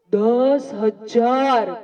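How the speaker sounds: drawn-out emphasis; slow and drawn out. Shown as a demonstration. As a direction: stretch each word slowly, weighty. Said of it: "કેટલા?".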